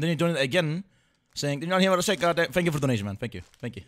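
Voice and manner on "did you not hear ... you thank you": ordinary talk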